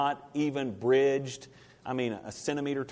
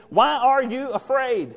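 slow, pointed question; 10 semitones higher, 7 dB louder, slower than usual. Acted. Jesus asking the disciples.

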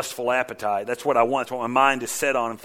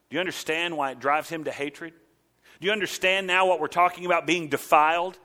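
ordinary talk

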